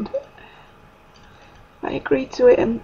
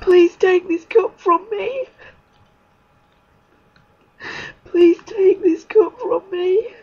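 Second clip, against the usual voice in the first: higher, slow anguished plea; slow tearful prayer, pitched up; tearful pleading; 6 semitones higher, 4 dB louder, slower than usual. Retold or imitated. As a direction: beg slowly, voice lifted in anguish. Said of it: "I can remember saying".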